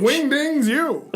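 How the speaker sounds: drawn-out fake swear; slow mock curse. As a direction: stretch the silly curse out slowly. Censored curse in mock fight.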